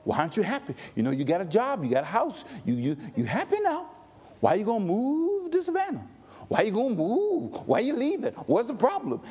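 a hint of a nagging relative; lightly mock the doubting family member. Pastor imitating family questioning a move, mildly mocking. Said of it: "We are trained to question".

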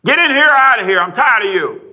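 loud, high fed-up shout; acted angry outburst, easing slightly at the end; irritated tone. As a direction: imitate an exasperated parent shouting orders. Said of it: "It's just a tone".

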